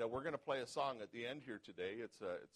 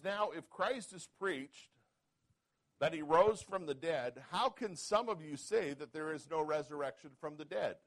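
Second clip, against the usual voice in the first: scripture reading; 4 semitones higher, 6 dB louder than usual. Acted. Pastor reading Paul's question to the church, preaching. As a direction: read Paul's question with weight. Pastor reads Corinthians aloud.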